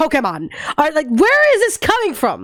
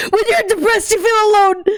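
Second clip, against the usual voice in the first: laughing; 6 semitones higher than usual. Real, not acted. Joking about depression while laughing.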